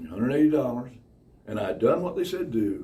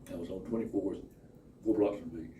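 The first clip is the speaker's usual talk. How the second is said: normal talking voice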